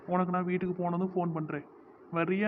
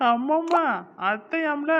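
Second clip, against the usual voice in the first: high, laughing jeer; gleeful mock amazement; teasing mockery; 7 semitones higher, 4 dB louder, slower than usual. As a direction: poke fun in a raised, amused pitch. Mocks the girl calling her aunt.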